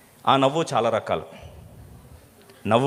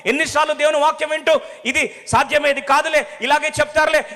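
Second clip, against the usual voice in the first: loud, high preaching with rising fervour; full-force preaching; 13 semitones higher, 3 dB louder than usual. Acted. A preacher challenging the congregation's doubts, loudly. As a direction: drive the warning home to the congregation.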